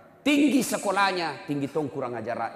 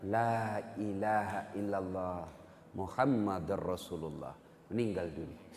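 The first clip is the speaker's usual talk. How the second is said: slow, much lower and softer; dying words; 6 semitones lower, 7 dB quieter than usual. Retold or imitated. Dying son struggles to say the shahada, slow and soft. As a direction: fade out as a man breathes his last.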